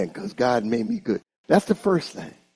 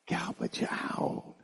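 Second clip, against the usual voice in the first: slow whispered "Garbage out"; whispered punchline; 5 semitones higher, 11 dB quieter, slower than usual. Real, not acted. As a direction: drop to a whisper for effect. Punchline answer to congregation.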